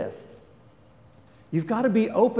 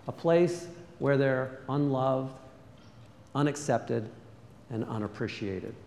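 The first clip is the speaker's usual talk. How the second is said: ordinary talk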